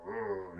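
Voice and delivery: low, soft grumbling grunt; wary orc grunt. Acted. Orc doesn't trust the halfling wizard, wary grunt. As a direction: grumble distrustfully, low in the throat.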